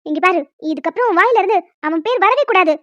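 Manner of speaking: loud, fast exasperated scolding; a mother fed up with her daughter. Acted.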